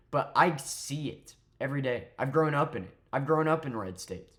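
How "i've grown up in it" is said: ordinary delivery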